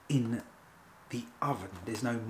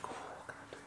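whisper; 16 dB quieter than usual. Pretend. Waitrose panicking over Heston's idea.

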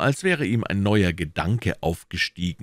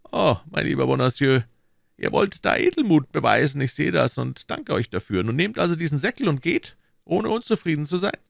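higher, pressing questions as the Cardinal; Cardinal questioning; 10 semitones higher, 3 dB louder than usual. Acted. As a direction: interrogate his agent coolly. (der Kardinal, verhörend)

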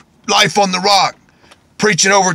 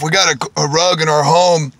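normal talking voice

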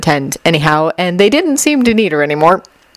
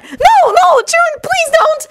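very high, panicked begging; pleading; much slower than usual. A narrator's reading. Shinobu begs Jun to spare her cards, pleading. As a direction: beg in a stretched high squeal. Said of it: "Shinobu pleaded".

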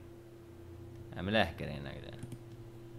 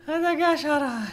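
silly high voice; 4 dB louder than usual. Pretend. Repeats line in put-on voice.

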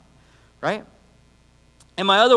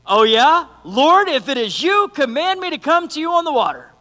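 eager Peter; 7 semitones higher, 6 dB louder than usual. Retold or imitated. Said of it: "Peter goes".

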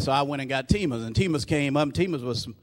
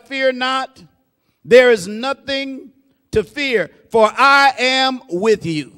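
raised, slow preaching cadence; 8 semitones higher, 7 dB louder, slower than usual. Acted. Preacher proclaiming God's promise to the congregation, loud and slow. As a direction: preach it out with full force.

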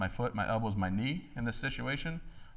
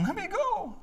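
high whiny mock plea from Johnny; mock crying plea; slower than usual. Boy with his hand crushed begs dad to let go, high mock crying. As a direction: play the crying boy begging, pitched way up. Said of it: "And as he's crying".

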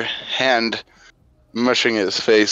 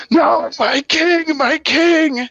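much higher, slow sobbing wail; weeping; 4 dB louder, slower than usual. Acted. Traitor sees the king's corpse, high sobbing wail. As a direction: grieve over the dead king. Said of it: "as he begins to weep".

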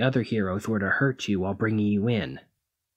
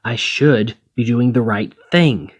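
slow, louder, forceful declaration; loud insistence; 7 dB louder, slower than usual. Acted. Hero forcefully telling the thief what duty demands, loud and slow. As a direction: hammer each word as if convincing yourself.

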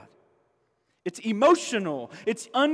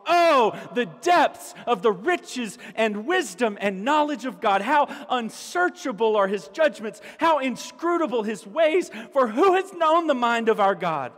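raised, ringing proclamation of praise; preaching the doxology; 4 semitones higher than usual. Acted. Preacher to the church, declaiming Paul's praise in full voice. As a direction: proclaim it like breaking into song.

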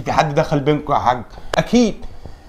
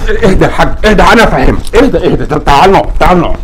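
loud calming; 7 dB louder than usual. Acted. Calming angry father.

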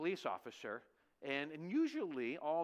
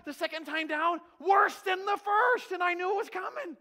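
higher, louder, stretched cry; fearful shout; 12 semitones higher, 12 dB louder than usual. Real, not acted. Preacher reliving the drop, crying out loud. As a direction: howl it like the drop itself.

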